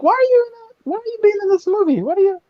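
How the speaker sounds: high-pitched, baffled questioning; mock incredulous. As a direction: squeal out a disbelieving reaction. Acted reaction to a film casting.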